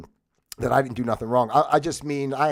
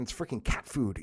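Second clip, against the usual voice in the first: muttered thought; 3 semitones lower, 8 dB quieter than usual. Retold or imitated. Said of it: "I'm thinking".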